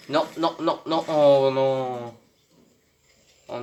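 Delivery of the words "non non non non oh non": dismayed wail. Real, not acted. Losing the last game.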